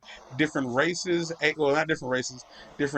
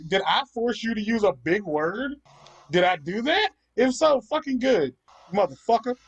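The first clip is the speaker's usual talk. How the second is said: higher, heated mock taunt; taunting comeback; 6 semitones higher than usual. Retelling his reply to a snarky commenter, heated taunt. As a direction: throw the insult back with relish.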